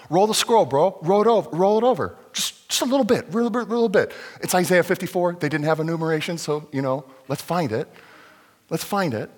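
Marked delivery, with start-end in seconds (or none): 0.1-4.1 louder, higher, pushy urging; can't wait for the next bit; eager bro voice. Acted. Eunuch urging scroll rolled again.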